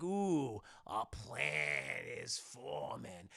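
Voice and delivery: soft, very slow, drawn out; scheming villain. Retold or imitated. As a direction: hatch a plot slowly under your voice. Villains scheme on seeing Goofy.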